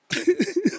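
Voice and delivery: high stuttering fake sobs; hammed-up high boo-hoo; mock crying. Man left alone with a crying girl, high mock sobs. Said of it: "now I'm crying".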